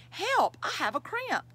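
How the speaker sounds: high, loud cry for help. A narrator's reading. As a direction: call out in acted alarm, pitch leaping up. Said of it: "she cried".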